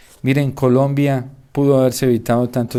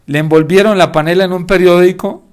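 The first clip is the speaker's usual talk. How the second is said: usual speaking voice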